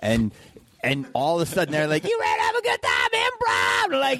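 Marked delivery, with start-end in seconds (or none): 2.0-3.9 high-pitched shouted crowd hype; hype announcer shout. Acted. Comic mimicking the club intro at Tempe Improv, shouted hype. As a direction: scream it like an overexcited club announcer.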